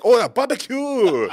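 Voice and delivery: high, slow, loud mock Japanese accent; Japanese tourist. Tourist amazed in an interview, mock Japanese accent. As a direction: marvel in exaggerated Japanese-accented English. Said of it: "they interview the Japanese tourists".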